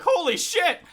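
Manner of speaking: imitating a wild excited outburst; losing your mind. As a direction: mimic a friend flipping out with joy. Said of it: "It's like losing your mind".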